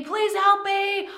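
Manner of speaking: hammed-up cry for help, lost in the woods; mock panicked scream. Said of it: "You may start screaming".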